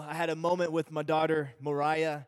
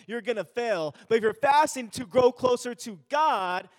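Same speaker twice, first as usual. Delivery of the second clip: louder, higher preaching push; rising preaching; 5 semitones higher, 5 dB louder than usual. Acted. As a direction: drive the point home from the pulpit. Preaching builds to full force.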